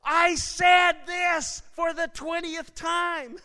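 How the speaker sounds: high, slow, drawn-out shout; exasperated divine shout. Acted. God answering for the twentieth time, exasperated shout. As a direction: shout it word by word, like the punchline. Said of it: "finally we get this signal come through, and he says".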